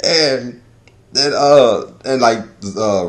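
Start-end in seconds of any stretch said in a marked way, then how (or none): none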